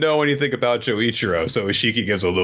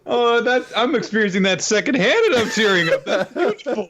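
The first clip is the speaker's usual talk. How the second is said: laughing; 12 semitones higher than usual. Real, not acted. Listener laughing, mock tearful.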